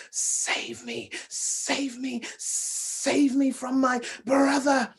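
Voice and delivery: soft, breathy, hissing snake voice begging for help; a tiny frightened snake calling out in a whisper. Acted. Small snake begging the princess to hide it, hushed whisper.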